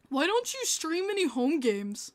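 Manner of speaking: lightly mock a clueless viewer's question; drawn-out put-on questioning. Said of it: "someone went".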